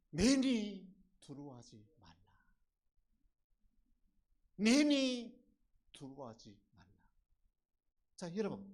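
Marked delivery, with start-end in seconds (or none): 0.1-2.1 booming first word, then dropping away; Jesus calling out. Acted. Quoting Jesus calling over the sea. 4.6-6.9 solemn call that falls away after a pause; Jesus calling out. Acted. Repeats Jesus calling over the sea.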